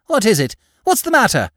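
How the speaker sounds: high, loud gasp of dismay. A narrator's reading. Wife sees her husband stammering, dismayed. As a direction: cry out in alarm. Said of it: "gasped in dismay".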